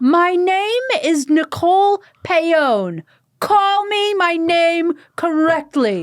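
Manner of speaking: high, loud and slow, each word hammered; fierce demand. Retold or imitated. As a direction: slam the table and demand your name. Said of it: "A star is born".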